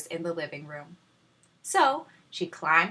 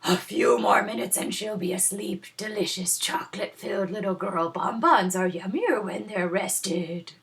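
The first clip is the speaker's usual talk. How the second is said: gloating dinosaur; 3 semitones higher than usual. A narrator's reading. Dinosaur gloats about sleeping Goldilocks, sly gloating voice. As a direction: savor the trap like a sly beast. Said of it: "a dinosaur gloating".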